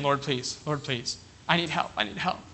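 whisper-soft plea to God; a hushed imitation of someone begging in prayer; soft pleading prayer.

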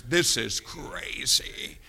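low, drawn-out awestruck whisper; hushed amazement. Acted. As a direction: breathe it slowly, as if marvelling at heaven. Acts out amazement in heaven.

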